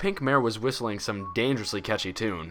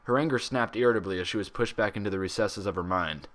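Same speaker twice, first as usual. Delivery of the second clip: ordinary delivery, nothing marked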